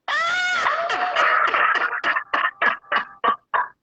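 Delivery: big shrieking laugh, head thrown back; real laughter at her own joke. Real, not acted.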